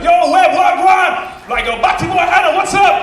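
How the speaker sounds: high, loud campus call-out; shouted greeting. Retold or imitated. Friend greets him across campus, loud shouted greeting. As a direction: holler it across the quad.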